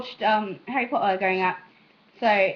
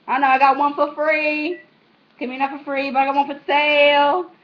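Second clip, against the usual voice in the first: louder, sassy mock-street joking; 5 semitones higher, 8 dB louder than usual. Vlogger joking to viewers about selling a kidney, loud and sassy. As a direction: play it up as a brash hustler selling a kidney.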